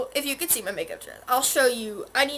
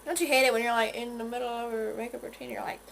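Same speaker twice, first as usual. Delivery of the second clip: usual speaking voice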